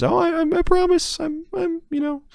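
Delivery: very high, trembling mock whimper; mock a cowering, begging weakling; squeaky pleading falsetto parody. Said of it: "he cowers and says".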